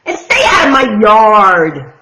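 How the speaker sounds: loud, cross order; grumpy yell. Acted. Hank yells at the jackrabbit to leave, loud. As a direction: shout him off the yard. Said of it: "Hank yelled back".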